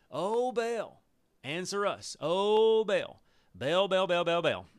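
loud, high pleading cries to Baal; shouting to Baal. Acted. Prophets crying out to their god, loud and pleading.

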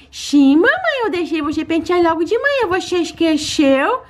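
high, sweet little pet voice begging for treats; baby-voiced pet. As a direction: talk like a greedy cute puppy asking mommy. Lilo answers.

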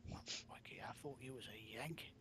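whispered tease. Pretend. Teasing line.

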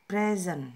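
PRE-sent with first syllable stressed. Shown as a demonstration. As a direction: say present as PREsent, noun. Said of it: "Di sini ada kata".

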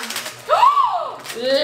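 big high gasp of surprise; excited gasp. Real, not acted. Revealing Llama's gift, excited gasp.

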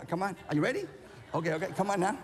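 normal talking voice

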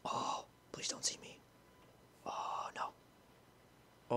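scared whisper; hiding player begging in a whisper. Real, not acted. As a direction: breathe it so nobody hears. Hiding from enemy.

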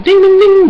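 high, loud sung-out ding ding ding; bell imitation. Fan imitating the bell as round two starts, high and loud.

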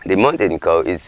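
plain reading voice

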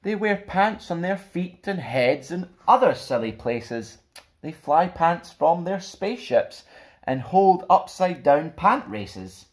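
put-on lively, bouncy picture-book narration for kids; animated rhyming read-aloud; storybook reading. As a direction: read it like a fun bedtime story to children. Reads picture book to children.

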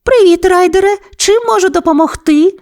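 very high, cheery husky pup voice; Еверест. A narrator's reading. Husky pup answers Ryder's call, very high voice. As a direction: greet in a squeaky-high puppy voice.

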